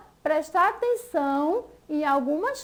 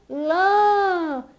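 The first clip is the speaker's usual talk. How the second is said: "lã" alone, long and drawn out; stressed syllable isolated. Shown as a demonstration. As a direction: hold "lã" as the strong syllable for the class. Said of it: "a sílaba mais forte".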